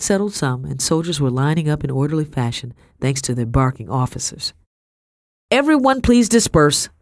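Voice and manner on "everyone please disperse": loud, high official command; shouted order. A narrator's reading. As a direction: bark the crowd to disperse. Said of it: "shouted a broad-shouldered man".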